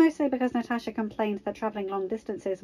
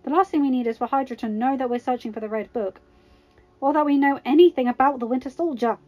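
higher, louder scolding exclamation; exclaiming; 5 semitones higher, 6 dB louder than usual. A narrator's reading. Natasha reining Tony in before the bell, exclaiming. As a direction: exclaim in emphatic, raised-pitch protest. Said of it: "Natasha exclaimed".

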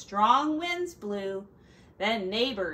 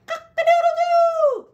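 high, loud crowing cock-a-doodle-doo; rooster crow; 11 dB louder than usual. Acted. Farmer trying to shout, crowing like a rooster. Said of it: "he tried to shout".

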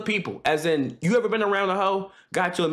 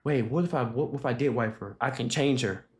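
inner thought; 10 semitones lower, 5 dB quieter than usual. Retold or imitated. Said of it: "negative thoughts start".

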